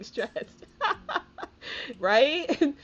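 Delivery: whispered then laughing out loud; breathy laugh then a loud high Right. Real, not acted. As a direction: giggle through the last word. Laughing about getting caught feeling.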